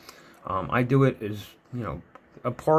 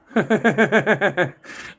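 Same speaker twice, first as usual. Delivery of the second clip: embarrassed laugh; 7 semitones higher, 6 dB louder than usual. Real, not acted. Laughs at own confession.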